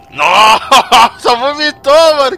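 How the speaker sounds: laughing disgust. Real, not acted. Reacting to gross game scene.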